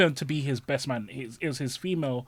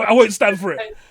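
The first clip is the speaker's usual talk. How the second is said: much louder, higher declaration; loud refusal; 8 semitones higher, 13 dB louder than usual. Retold or imitated. Refusing a friend's plan, loud and high. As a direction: declare it loudly. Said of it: "I wouldn't accept".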